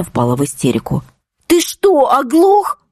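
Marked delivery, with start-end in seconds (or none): 1.5-2.8 hysterical breaking shout. Acted. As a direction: yell at the driver in hysterics. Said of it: "надрывно закричала дочь срывающимся голосом". (надрывный крик срывающимся голосом)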